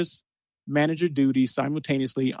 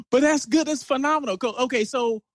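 excited; 9 semitones higher than usual. Real, not acted. Host laughing after breathless intro.

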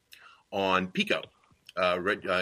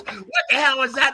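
a much higher, louder mock what-the-hell; retold disbelief; 7 dB louder than usual. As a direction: toss it out in amused disbelief. Owner reacts to awkward encounter.

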